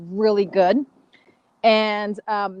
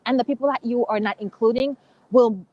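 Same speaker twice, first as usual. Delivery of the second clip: usual speaking voice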